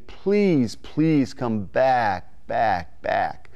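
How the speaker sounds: beg, then let it fall away; begging plea. Shown as a demonstration. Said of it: "So you're just begging".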